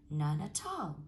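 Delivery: usual speaking voice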